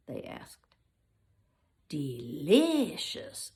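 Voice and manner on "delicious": very slow, drawn-out relish; the lion relishing his edible suit; savouring. Acted. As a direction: savour the word like a treat. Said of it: "said the lion".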